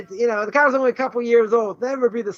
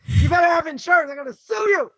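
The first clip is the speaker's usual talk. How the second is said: higher, laughing mock tirade; mock angry yell; 6 semitones higher than usual. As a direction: ham up the furious driver threatening to sue. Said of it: "jump up and down and yell and scream".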